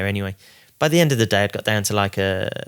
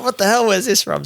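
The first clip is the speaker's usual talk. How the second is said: much higher, astonished; shocked reaction; 12 semitones higher, 4 dB louder than usual. Retold or imitated. Cousin seeing a photo of the cash, shocked. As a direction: gasp it out as total disbelief.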